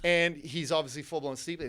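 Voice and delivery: ordinary talk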